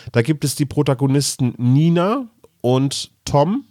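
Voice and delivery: ordinary talk, nothing marked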